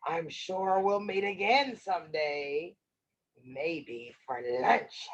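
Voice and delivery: sly goodbye, slowing on the last aside; wolf. Acted. Wolf bids Little Red goodbye, low sly voice. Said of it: "The wolf said".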